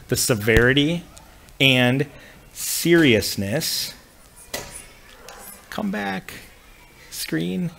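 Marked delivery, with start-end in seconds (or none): 5.7-7.7 soft, higher-pitched talk to oneself; quiet aside. Real, not acted. Teacher to the screen that went away, quiet aside. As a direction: coax it under your breath, pitched up. Talking to the screen mid-lesson.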